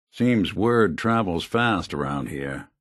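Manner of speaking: normal talking voice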